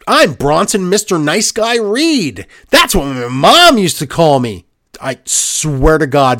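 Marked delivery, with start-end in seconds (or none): 0.1-2.5 higher, slow, lightly hammed-up wrestler introduction; mock promo introduction. 2.7-4.7 louder, lightly hammed-up wrestler boast; mock promo boast. Continues mocking wrestler's promo.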